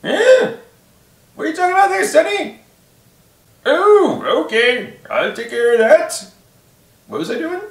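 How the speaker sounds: put-on high, creaky old codger; old man voice. Shown as a demonstration. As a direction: play a cranky old grandpa. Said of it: "Example, like, ... a different voice for it".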